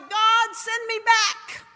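high, drawn-out retold plea; acted desperate pleading, trailing away; crying out. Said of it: "I was crying out and saying".

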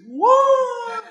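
a very high, startled, laughing what; shocked what. Retold or imitated. Preacher watching the woman fly, squealing in shock. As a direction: squeal it in disbelief.

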